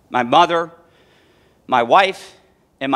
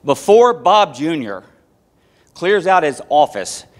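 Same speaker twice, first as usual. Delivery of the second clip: ordinary delivery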